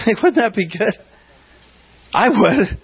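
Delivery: high laughing aside to the church. Real, not acted. Pastor laughing at his own confession to the church, laughing.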